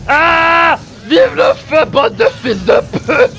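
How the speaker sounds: long high yell into shouted cursing; wild, furious war cry; battle scream. Real, not acted. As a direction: roar defiance at full volume. Screaming in the boss fight.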